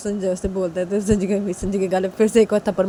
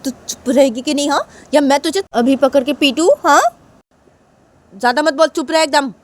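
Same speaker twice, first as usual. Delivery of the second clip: loud, higher-pitched threat; acted angry mother; stern scolding; 7 semitones higher, 6 dB louder than usual. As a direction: warn your daughter to shut up. Mother threatens elder sister.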